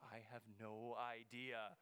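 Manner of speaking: low, slow admission of cluelessness; mock-helpless, dragging each word low; clueless look voiced. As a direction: draw it out, acting utterly at a loss. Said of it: "I had that look that said".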